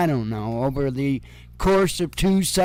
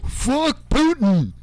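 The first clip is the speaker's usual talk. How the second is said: slow, higher, drawn-out defiant mock curse; mock Prigozhin defiance; 9 semitones higher, slower than usual. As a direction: ham up a rebel's slow jeer at Putin. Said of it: "Prigozhin's like".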